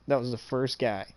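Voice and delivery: ordinary talk, nothing marked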